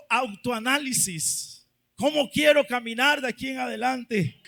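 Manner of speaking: usual speaking voice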